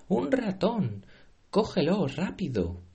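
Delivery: high panicked shriek. Acted. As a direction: scream it, chasing the mouse.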